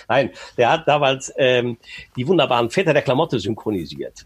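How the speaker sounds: usual speaking voice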